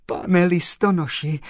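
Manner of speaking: high, loud, grateful address; overjoyed. A narrator's reading. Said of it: "oči se jí zalily slzami radosti". (radostně a hlasitě, vysokým hlasem)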